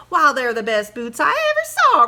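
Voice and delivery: loud, delighted cry; cried out. Acted. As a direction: exclaim with joy at the boots. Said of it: "cried his wife".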